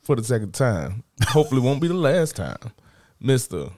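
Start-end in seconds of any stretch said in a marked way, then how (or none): 3.2-3.7 announcer intro. Acted. Introducing guest on stage.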